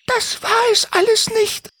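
sehr hohe, klagende Vogelstimme; klagend. Acted. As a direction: jammern wie eine kleine Drossel. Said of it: "klagte Doris". (Doris klagt Timmy vor, hoch und jammernd)

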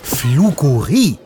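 slow, drawn-out hiss of the name; a stretched, annoyed hiss. Acted. Sarah scolding the hidden lightning, slow hissed name. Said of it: "zischt sie".

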